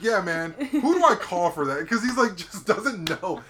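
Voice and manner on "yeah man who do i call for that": higher, louder confused dude; bewildered caller. Retold or imitated. Ice cream store owner asks who to call, loud. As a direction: sound lost and loud.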